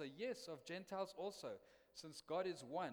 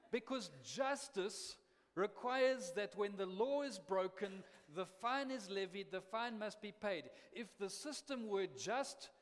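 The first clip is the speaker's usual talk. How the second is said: louder, higher, driving sermon delivery; full-force preaching; 6 semitones higher, 6 dB louder than usual. Acted. Preacher hammering justice home to his congregation, full-force preaching.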